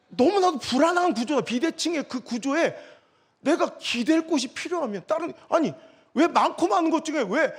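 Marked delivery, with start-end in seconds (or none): none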